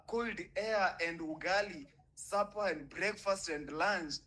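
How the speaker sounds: ordinary delivery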